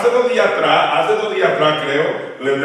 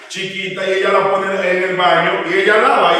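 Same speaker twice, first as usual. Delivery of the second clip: ordinary talk, nothing marked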